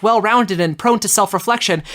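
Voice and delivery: ordinary delivery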